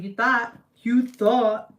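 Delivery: loud, punchy taunt; mock-triumphant, emphatic jeer; gloating retort. Gloating retort to her mom.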